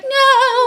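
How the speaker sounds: long, high-pitched wail; hammed-up cry of horror; mock anguished cry. Acting out heroine seeing mother killed.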